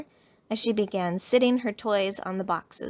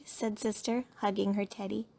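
ordinary delivery

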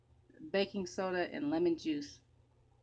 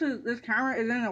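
toothbrush in mouth; foamy mouth, muffled words; talking while brushing teeth; 7 semitones higher, 8 dB louder, faster than usual. Real, not acted. Seen 2: Brush in mouth until about 2.1s, then foamy teeth only.

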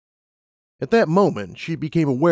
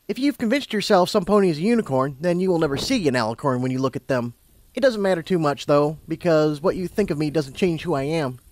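higher character voice, explaining; lightly acted, matter-of-fact unicorn; lightly voiced unicorn; 5 semitones higher than usual. A narrator's reading. Unicorn explaining herself to Lumina, lightly voiced.

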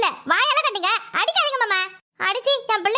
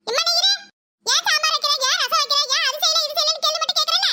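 put-on higher, defiant kid lecturing the grandfather; angry boy voice; 5 semitones higher than usual. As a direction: fire off the complaints like a furious boy.

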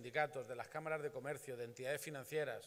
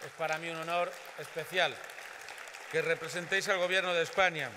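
louder, higher, project to the room; loud formal thanks; 3 semitones higher, 10 dB louder than usual. Real, not acted. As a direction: thank the government proudly. (agradecimiento en voz alta)

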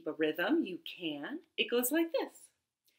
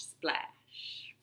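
whispered splash; 9 dB quieter than usual. Pretend. Frogs all gone.

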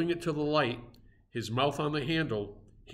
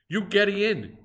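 higher, louder broken-English offer; pidgin invitation; 7 semitones higher, 6 dB louder than usual. A narrator's reading. Harpooner invites the stranger into bed, pidgin invitation. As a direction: wave him into bed kindly.

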